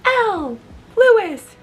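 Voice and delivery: very high pupil replies; answering students. Acted. Students answering their teacher, high-pitched voices. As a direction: call out names as eager pupils. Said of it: "They say".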